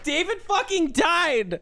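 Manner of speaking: high, slow outraged wail; outraged cry. Real, not acted. Outraged cry to viewers that her Sim David died. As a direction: wail it out at the game. Reacting to Sim's death.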